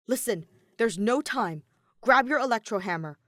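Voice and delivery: robot giving a loud, urgent order; hurried order. Acted. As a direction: push him to hurry. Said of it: "Kiba ordered him hurriedly".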